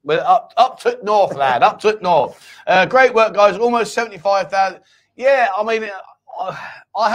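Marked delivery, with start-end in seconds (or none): 0.2-2.5 loud, slow put-on Yorkshire accent; mock Northern accent. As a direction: ham up a Northern English lad. Mocks northern speech about Yorkshire.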